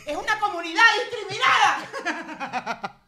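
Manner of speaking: high, slow cry breaking into laughter; laughing outburst. Real, not acted. Repeating the point after apologising for shouting, high and laughing. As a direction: proclaim it high, then crack up.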